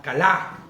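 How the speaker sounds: kalá said ka-LÁ, weight on the last A; stress on the A. Shown as a demonstration. Teacher showing the class how to say kalá, stress on the final A. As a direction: land hard on the final A of kalá. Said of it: "Con acento en la A".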